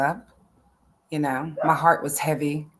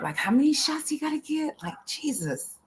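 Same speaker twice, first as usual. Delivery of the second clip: breathy whispered doubt, pitched up; 9 semitones higher, 5 dB quieter than usual. Retold or imitated. Mother whispering her doubt about so many shots. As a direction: whisper the worry to yourself.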